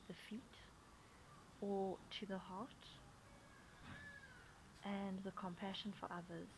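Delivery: soft, unhurried meditation voice with long pauses; guided meditation. Acted. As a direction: gently guide them into the breath, leaving space between phrases. Leads breathing and meditation.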